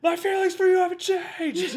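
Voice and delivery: high, loud dramatic cry with laughter; anime shout. Pretend. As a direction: belt it like an anime hero. Anime-style line, laughing.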